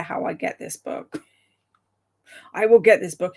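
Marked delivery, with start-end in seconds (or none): 2.5-3.3 firm, louder vow with stress on will; determined. Real, not acted. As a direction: promise it with resolve. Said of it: "It's my mission in life now".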